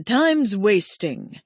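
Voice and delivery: slow, drawn-out irritation; irritated. A narrator's reading. Sariel pressing Genesis to make a choice, irritated. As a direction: let mild impatience stretch the words. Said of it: "said in an irritated voice".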